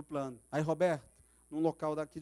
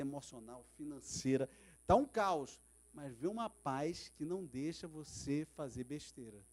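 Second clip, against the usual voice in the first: ordinary talk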